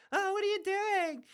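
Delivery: mocking, very high-pitched girlish squeal; Sansa. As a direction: put on a silly falsetto to mock her. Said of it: "a douchebag to her sister".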